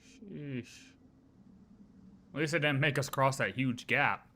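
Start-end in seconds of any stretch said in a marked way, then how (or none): none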